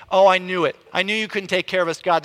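quick, accusing complaint; grumbling. Acted. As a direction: grumble loudly as if proven right. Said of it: "See, with grumbling".

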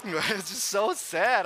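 laughing it out, slow and pained. Real, not acted. Preacher laughing at the kids' sad experiment, slow. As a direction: laugh through the sad words.

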